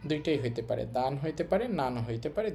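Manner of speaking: ordinary talk, nothing marked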